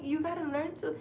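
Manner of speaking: gentle grandma advice, barely put on; sweet old psychic. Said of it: "She's like".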